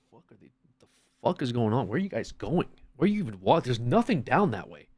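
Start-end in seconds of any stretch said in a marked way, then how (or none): none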